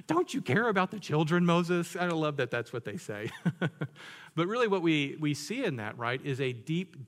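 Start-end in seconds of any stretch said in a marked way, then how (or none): 0.1-2.0 high, loud mock outrage at Moses; mock indignation. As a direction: wail it like an overdramatic accuser. Mocking the Israelites' complaint.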